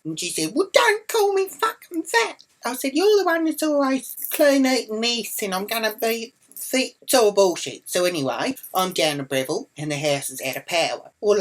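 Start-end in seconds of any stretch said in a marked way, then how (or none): 0.5-2.4 very high whiny imitation; mock tearful protest. Woman crying after being insulted, mock tearful protest. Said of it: "she starts crying". 2.9-7.0 high, loud argumentative retort; retold angry retort. Arguing back in the kitchen, retold angry retort.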